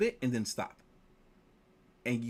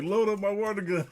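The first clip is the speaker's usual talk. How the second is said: higher, louder mock command; dad ordering his daughter, mock loud; eager order; 8 semitones higher, 6 dB louder than usual. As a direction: bark the order with glee. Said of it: "He's like".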